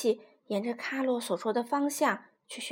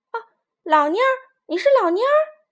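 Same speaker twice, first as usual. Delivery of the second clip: high, loud cry of recognition; surprised exclamation at an old friend; astonished cry; 10 semitones higher, 9 dB louder than usual. Acted. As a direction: shout his name in disbelief. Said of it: "皮迪克吃惊地叫道".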